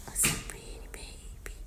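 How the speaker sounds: soft whisper to a cat. Real, not acted. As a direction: breathe the praise gently.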